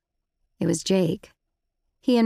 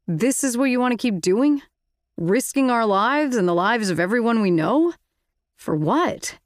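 sour; 5 semitones higher, 3 dB louder than usual. A narrator's reading. Marco's sour challenge.

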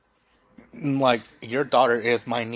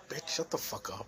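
much softer, under the breath; muttered under breath; 2 semitones lower, 13 dB quieter than usual. Retold or imitated. To the ranting sister-in-law, a muttered insult under the breath. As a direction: say it so she can't hear. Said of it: "I'm like".

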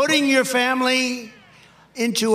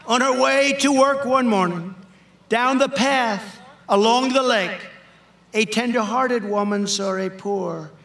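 dramatic poem recital; 3 semitones higher than usual. Acted. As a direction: perform the poem for the crowd. Recites the snake poem to crowd.

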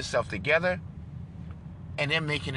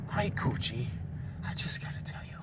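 sly whisper; 3 semitones lower, 7 dB quieter than usual. Real, not acted. Sly aside.

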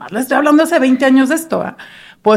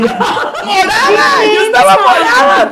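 much higher, slowly stretched word; drawn-out emphasis; 8 semitones higher, slower than usual. Retold or imitated. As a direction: stretch it out for effect. Stressing she turned purple.

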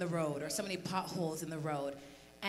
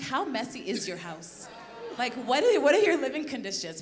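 retold disbelief, higher and louder; mock incredulous; 6 semitones higher, 8 dB louder than usual. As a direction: tease him, half-joking shock. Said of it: "I'm like".

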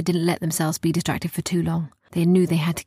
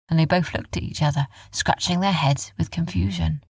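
ordinary delivery, nothing marked

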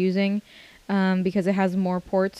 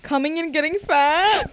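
mock surprised; 7 semitones higher than usual. Teasing a friend's odd phrase.

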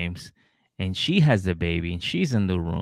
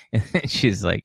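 ordinary delivery

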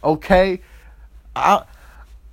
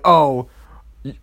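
normal talking voice

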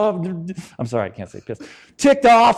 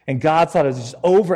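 ordinary talk, nothing marked

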